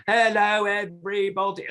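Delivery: very high, big showy hello; overdone puppet greeting. Shown as a demonstration. Puppeteer showing kids a too-busy puppet hello. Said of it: "rather than just going".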